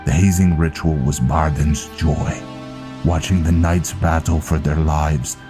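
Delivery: Viking recounting a grim past. Acted. Viking reminding Velen of the ambush, solemn storytelling. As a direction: tell the old tale to a comrade, projected.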